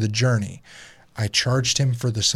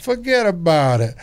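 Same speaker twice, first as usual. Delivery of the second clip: higher, louder New York accent, "Forget about it" hammed up; 6 semitones higher, 4 dB louder than usual. Host tops the friend's New York bit, louder and hammed up. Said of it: "New York accent would go".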